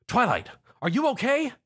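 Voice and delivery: high worried call; concerned call. A narrator's reading. Zio calls to Twilight, high and worried. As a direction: call out to her in alarm. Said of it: "Zio yelled with concern".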